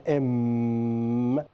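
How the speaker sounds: "M" drawn out into a long hum; "M" stretched long and level; held M sound. Shown as a demonstration. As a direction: hold the nasal at the end of "M". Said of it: "when you pronounce the next word".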